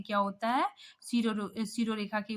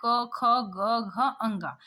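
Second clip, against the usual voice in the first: slow recital; 3 dB louder, much slower than usual. Shown as a demonstration. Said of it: "ये क्या है?".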